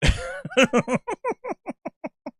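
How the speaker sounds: long high laughing fit; laughter. Real, not acted. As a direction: laugh high until it trails away. Cracks up at own bit.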